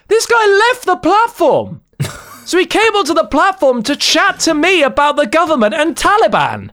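high, incredulous laughing voice; laughing disbelief. Real, not acted. Telling a friend about the strange homeless man, laughing in disbelief. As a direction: laugh through how absurd it was.

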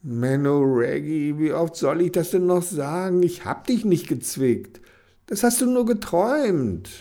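high, exasperated whine; exasperated groan. Acted. Kenny tells Reggie again she did not pinch, exasperated. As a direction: groan it out, fed up. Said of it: "Kenny stöhnte".

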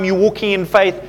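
ordinary talk, nothing marked

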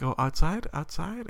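put-on high, rising coaxing voice for a toddler; coaxing excitement. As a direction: tempt the kid outside with rising glee.

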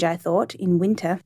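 usual speaking voice

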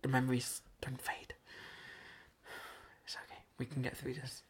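very soft, near-whispered reassurance; breath-only, fragile murmur of comfort; hushed self-soothing. Pretend. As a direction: whisper it as if steadying yourself. Consoling themselves about bad memories.

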